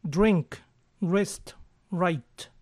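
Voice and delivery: "Drink", "rest", "write" said cleanly; model words. Shown as a demonstration. Teacher models the three words for students, careful. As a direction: read the three words clearly. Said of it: "yo coloco la pronunciación de este modo".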